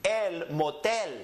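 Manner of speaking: slow El motel, el mo-TEL; last-syllable stress. Shown as a demonstration. Teacher models for students to read, El motel slowly stressed on TEL. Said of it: "we're going up on the N".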